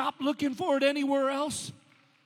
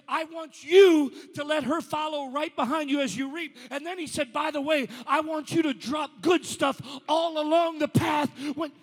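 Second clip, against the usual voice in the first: louder and louder until shouting it to the congregation; preaching at full force; 7 dB louder than usual. Acted. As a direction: climb into a roaring preacher's cry.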